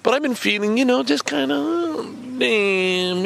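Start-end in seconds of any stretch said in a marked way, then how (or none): none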